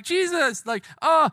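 high-pitched pretend wail; mock crying. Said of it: "with Mary crying and being like".

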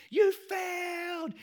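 put-on family cheering the boy's failure, shouting; celebrating shout. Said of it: "They start screaming and clapping".

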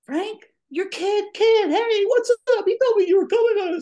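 very high and loud, overjoyed welcome; delighted friend. Retold or imitated. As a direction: boom out a happy welcome. Frank arrives, greets happily.

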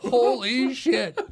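a loud, high, slow "holy shit", laughing; amazed. Real, not acted. Retelling finding a huge bar inside a hill, amazed laughter. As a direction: let the amazement spill out.

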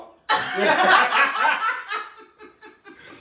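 high-pitched burst of laughing; high laughter. Real, not acted. Laughing at his own cocaine story, high laugh. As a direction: let a high laugh break out.

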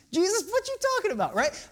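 high, fast, mocking disbelief; sarcastic disbelief. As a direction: toss it off as an incredulous jab. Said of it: "gets even more sarcastic".

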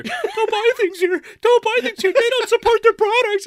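hammed-up wailing customer; screaming exit. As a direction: wail it while storming out. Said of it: "starts walking out".

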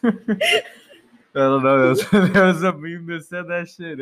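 high, laughing through the words. Real, not acted. Explaining a meme to partner, laughing. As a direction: crack up while explaining. Cracks up after partner's startled "What?.

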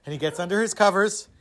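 usual speaking voice

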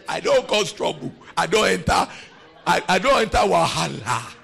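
building from firm to loud insistence; I don't enter wahala, shouted; rising protest. Pretend. Protesting innocence to God.

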